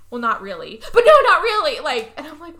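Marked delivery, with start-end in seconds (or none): 0.9-1.8 high, loud protest; mock emphatic denial. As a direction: repeat it loudly with exaggerated insistence.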